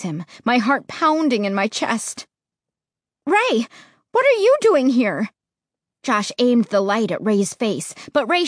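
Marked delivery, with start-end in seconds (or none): 3.3-5.3 high, loud startled cry of a name. Acted. Narrator startled by Ray appearing out of the darkness, loud and high. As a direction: blurt it out, caught off guard in the dark.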